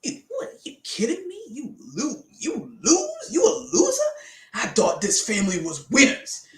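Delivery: high, hammed-up scornful rant; mock self-berating. Coach parodying harsh self-blame to listeners, mock scolding voice. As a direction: jeer in a put-on voice, building in volume. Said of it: "Don't be like".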